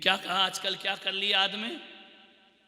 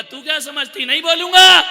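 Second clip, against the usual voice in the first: high shout building to the end; angry retort; 8 semitones higher, 16 dB louder than usual. Acted. Husband answering his wife in a quarrel, shouting angrily. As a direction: snap back, rising to a yell.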